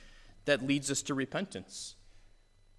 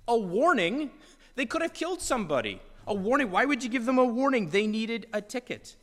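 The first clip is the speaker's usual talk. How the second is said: higher, louder, quick outraged questions; incredulous at the lenient cop; indignant disbelief; 6 semitones higher, 4 dB louder than usual. Retold or imitated. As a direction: driver got off with a warning, indignant.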